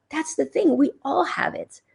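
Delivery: ordinary talk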